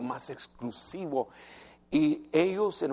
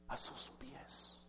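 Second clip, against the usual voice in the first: hushed whisper; 3 semitones lower, 21 dB quieter, slower than usual. Acted. Preacher hushes at the woman's posture.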